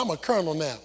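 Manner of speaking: a hint of puffed-up pride; smug colonel. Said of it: "he's smelling himself".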